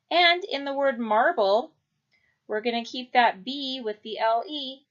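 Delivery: ordinary delivery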